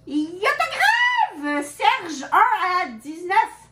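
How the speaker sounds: high, slow, booming title call; announcer voice. Acted. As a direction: announce it like a movie trailer. (voix d'annonceur, lente et aiguë)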